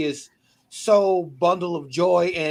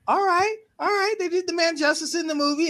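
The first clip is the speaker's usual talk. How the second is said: high-pitched, conceding all right; mock inner voice, pleasantly persuaded; retold approval; 11 semitones higher than usual. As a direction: voice lifted well up, granting cautious approval. Retelling his approving reaction to movie.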